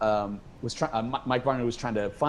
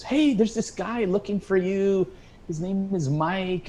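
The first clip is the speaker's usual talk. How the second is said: lightly voiced mother, higher and drawn out; a higher, slow mock-mother voice; mother on phone; 7 semitones higher, slower than usual. Mother phoning her son with news, lightly imitated, higher. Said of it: "my mother calls me and she goes".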